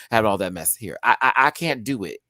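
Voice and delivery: ordinary delivery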